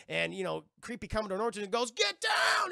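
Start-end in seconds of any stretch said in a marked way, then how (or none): 1.9-2.7 much higher, shouted warning; urgent shout. Retold or imitated. The commodore warning everyone of the cannon fire, a high shout.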